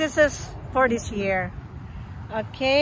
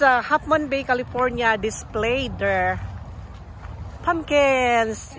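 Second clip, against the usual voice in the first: ordinary talk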